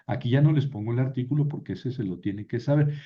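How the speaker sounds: ordinary talk, nothing marked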